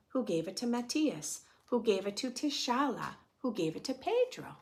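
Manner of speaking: put-on gentle read-aloud for children; a teacher reading a picture book aloud; storybook narration. A narrator's reading.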